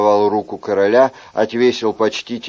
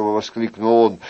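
ordinary delivery

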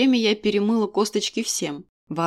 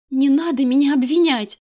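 higher, louder furious order; furious demand; 5 semitones higher, 6 dB louder than usual. Acted. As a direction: demand it with rage. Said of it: "яростно потребовала я".

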